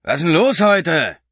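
loud, high, slow bellow over the music; taxi driver yelling. A narrator's reading. Driver shouting back at Mark in the taxi, loud. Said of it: "schrie der Taxifahrer nach hinten".

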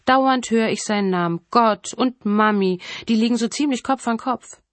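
mocking killer. Killer mocks victims calling for God, scoffing. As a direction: scoff with a slowed, savoured emphasis.

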